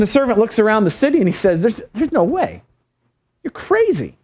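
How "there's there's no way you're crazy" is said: stammered incredulity leaping high at the end; high-pitched acted protest; mock disbelief. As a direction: play the servant who thinks his master has lost it. Servant disbelieves the prophet in acted story.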